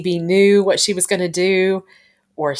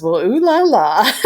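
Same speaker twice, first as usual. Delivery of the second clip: higher, slow, louder teasing sing-song; teasing ooh la la; 5 semitones higher, 5 dB louder than usual. Retold or imitated. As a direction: tease them like a knowing friend. Quoting Wilma teasing the couple.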